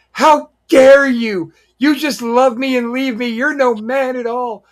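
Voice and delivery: high, loud indignant woman's scolding; hammed-up scorned lady telling him off; high, furious put-on woman's voice; mock outraged woman. Wrestler confronts him in bar.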